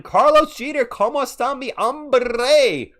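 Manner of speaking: high, loud, drawn-out greeting; exuberant Spanish shout. Real, not acted. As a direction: holler a big Spanish welcome, stretching the end.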